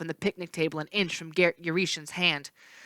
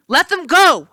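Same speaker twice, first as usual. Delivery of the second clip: high, loud angry yell; angry demand; 10 semitones higher, 9 dB louder than usual. A narrator's reading. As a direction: shout at the captors. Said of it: "I yelled".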